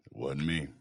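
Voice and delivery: soft, low sulky mumble; mumbled mock denial. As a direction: shrug it off quietly.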